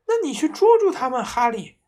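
high, loud and fast frightened plea; scared wife urging husband; trembling urging. A narrator's reading. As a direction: push him out in panic. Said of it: "妈妈还是颤抖着说".

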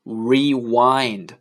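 "rewind" drawn out as ree-wined; slow word demo. Shown as a demonstration. Teacher naming the arrow symbol in English, slow drawn-out demonstration. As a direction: stretch both syllables of "rewind". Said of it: "它的英文就叫".

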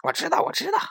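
high, loud squeal of a little rabbit; shrieky know-it-all bunny; shouting on the run. A narrator's reading. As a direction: yell it back while dashing off. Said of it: "一边跑还一边嚷嚷着".

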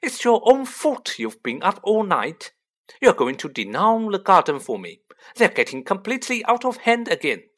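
high, loud telling-off; scolding mother. A narrator's reading. Mother ordering tired sons to the garden, scolding.

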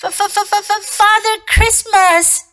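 high, terrified stutter; stammering fox. A narrator's reading. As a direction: stammer it out in fear. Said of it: "stammered the fox".

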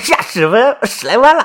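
high, gleeful exclamation breaking into laughter; thrilled disbelief. Acted. Young man hearing his share of the loot, thrilled. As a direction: blurt it out with delighted excitement.

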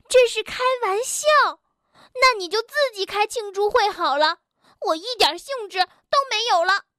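high, fast indignant outburst. Acted. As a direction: fire it off crossly, pitched high. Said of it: "蹦蹦大声地说". (气冲冲地大声说)

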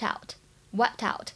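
"wiped out" said slowly, the final t of "wiped" running straight into "out"; slow linked "wiped out". Shown as a demonstration. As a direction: link "wiped" and "out" into one slow word. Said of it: "它跟后面的out是有连读的现象".

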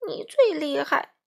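high, strained concession; groaning. A narrator's reading. Crow squashed under the wolf, groaning. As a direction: groan out the surrender. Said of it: "花袜子哼哼着".

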